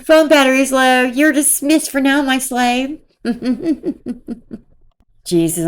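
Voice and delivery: usual speaking voice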